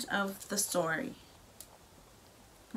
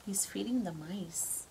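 whisper; 6 dB quieter than usual. Real, not acted. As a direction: whisper it close and gentle. Confiding to child during story.

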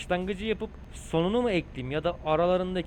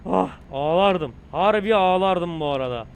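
loud relieved outburst; shaken relief; 8 dB louder than usual. Real, not acted. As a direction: let the fright pour out loudly. Relief after near crash. (rahatlamış, yüksek sesle)

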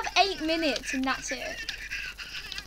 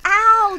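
sharp loud yelp of pain; 5 semitones higher, 10 dB louder than usual. Real, not acted. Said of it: "that actually hurt".

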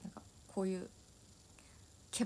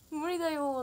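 higher, louder playful whine; teasing fan voice, higher pitch; mock fan whine; 7 semitones higher, 4 dB louder than usual. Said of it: "みたいな".

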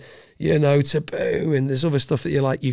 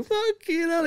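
mock surprised reaction; 3 dB quieter than usual. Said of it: "and he's like".

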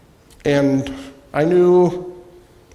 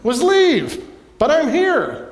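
exasperated inner shout; 13 semitones higher than usual. Retold or imitated. Pastor venting his thought at the rude wife, high mock shout. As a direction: blurt it out, fed up. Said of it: "I thought to myself".

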